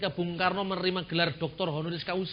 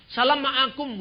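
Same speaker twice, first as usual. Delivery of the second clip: called greeting; 5 semitones higher, 5 dB louder, slower than usual. Acted. Acted greeting exchange.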